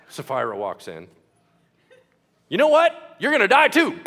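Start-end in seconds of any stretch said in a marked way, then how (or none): 2.5-4.0 loud, high hammed-up verdict; mock shout. Peter telling Sapphira she'll die too, hammed-up loud shout. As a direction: blurt the sentence at her.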